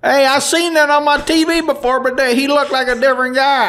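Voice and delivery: high comic voice of a man on TV; mock witness voice. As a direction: imitate an excited witness up high. Mimics a witness speaking.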